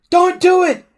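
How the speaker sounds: high, loud desperate plea; desperate shout. A narrator's reading. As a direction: scream it to save your life. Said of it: "Hiccup shouted desperately".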